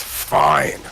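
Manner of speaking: unvoiced, snarled one word. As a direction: spit it out with a snarl. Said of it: "He snarls and says".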